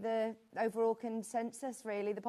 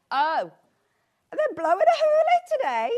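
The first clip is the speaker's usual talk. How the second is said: surprised exclaim; 10 semitones higher, 11 dB louder than usual. Real, not acted. Surprised reaction to viewer message.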